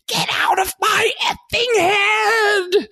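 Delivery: very high, drawn-out mock scream; imitated scream. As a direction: mimic an anguished scream way up high, stretching the words. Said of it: "and just screams".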